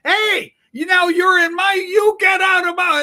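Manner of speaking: high, loud, indignant yelling; furious concertgoer claiming his seat; angry shout. Acted. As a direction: shout him out of your seat. Acted seat quarrel at concert.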